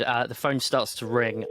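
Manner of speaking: normal talking voice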